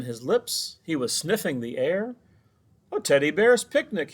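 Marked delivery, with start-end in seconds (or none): 2.9-4.1 loud, high delighted bellow; hungry bear bellows at the picnic. A narrator's reading. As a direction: bellow it with glee. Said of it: "he bellowed".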